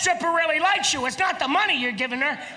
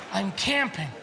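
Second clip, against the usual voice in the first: mock resigned; 5 semitones lower, 4 dB quieter than usual. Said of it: "I go".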